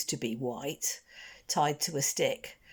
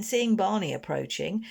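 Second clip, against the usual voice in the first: plain reading voice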